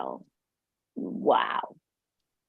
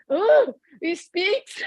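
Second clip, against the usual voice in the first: mock amazement; 10 semitones higher, much slower than usual. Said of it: "I go".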